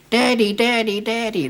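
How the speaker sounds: put-on American kid. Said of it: "run out of houses saying".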